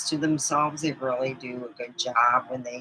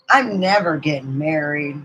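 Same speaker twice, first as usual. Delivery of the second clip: retold kid's vow, loud and drawn out at the end; defiant vow; 3 semitones higher, 5 dB louder, slower than usual. Child vowing to diary never to marry, defiant. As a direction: declare freedom, playfully emphatic.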